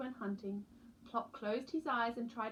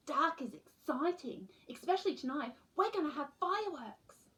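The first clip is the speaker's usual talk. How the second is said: put-on eager little boy; 6 semitones higher, 4 dB louder than usual. Boy voice in story.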